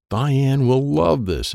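gleeful. A narrator's reading. Brother plans a prank.

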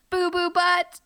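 high giggly build to punchline; goofy silly name. Pretend. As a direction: deliver the silly name proudly.

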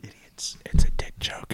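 hushed whispered aside; whispering. Real, not acted. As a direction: whisper it under your breath. Whispered aside after the pun.

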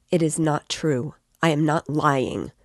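ordinary delivery, nothing marked

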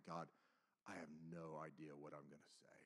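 very soft, low confession; almost inaudible plea, sinking away; helpless inward prayer. Retold or imitated. Teller praying before speaking to students, helpless murmur.

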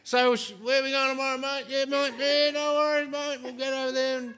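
high, broad Aussie tradie voice; aussie tradesman. Retold or imitated. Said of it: "They just sit in their utes and be like".

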